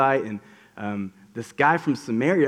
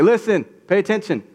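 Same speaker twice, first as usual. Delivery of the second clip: urgent call; 7 semitones higher, 5 dB louder than usual. Retold or imitated. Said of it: "He's like".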